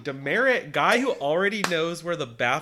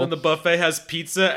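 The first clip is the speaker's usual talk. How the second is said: ordinary talk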